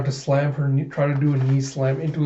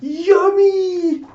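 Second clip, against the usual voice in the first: very high, loud squeal of delight; hammed-up high-pitched glee; gleeful squeal; 6 dB louder than usual. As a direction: squeal like a cartoon villain tasting dessert. Villain gleefully tastes his flan.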